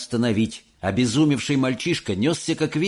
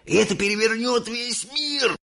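put-on high, eager old man's cry; excited cry; 11 semitones higher than usual. A narrator's reading. Grandpa crying out about the invention. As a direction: announce it to the world. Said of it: "воскликнул дедушка Джо".

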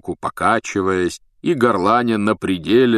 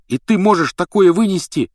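raised, louder indignant question; angered; 10 semitones higher, 5 dB louder than usual. A narrator's reading. Harry to Hermione, who holds him back, angered. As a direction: demand it angrily, pitched high. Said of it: "рассердился Гарри".

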